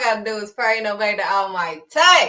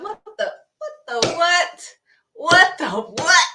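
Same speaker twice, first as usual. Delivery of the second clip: much higher, slow mock bewilderment; baffled what the what; 8 semitones higher, 6 dB louder, slower than usual. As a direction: act out confused disbelief. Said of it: "we like".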